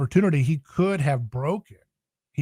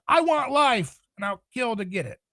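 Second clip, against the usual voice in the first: voicing Peter; 9 semitones higher than usual. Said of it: "in the mere opposite".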